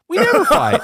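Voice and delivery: very high-pitched imitation of a blithe wife; high, innocent mock-wife voice; insistent wife. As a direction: mimic her sweetly, far up in pitch. Wife repeats her claim, retold.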